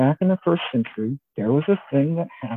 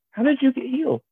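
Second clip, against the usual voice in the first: questioning Pharisee; 12 semitones higher than usual. Acted. Pharisees questioning the healed man.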